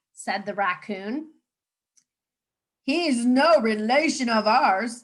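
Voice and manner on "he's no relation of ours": a touch of haughty declaring; proud frogs disowning him; declared. Acted. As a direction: sniff at the idea. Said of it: "declared the frogs".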